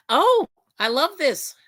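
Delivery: high, loud, drawn-out delight. Real, not acted. Streamer reacting to a viewer's birthday message, delighted. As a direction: gush over what you just saw.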